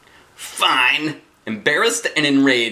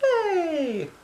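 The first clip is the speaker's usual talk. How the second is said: cheer. Real, not acted. Cheering the rescue.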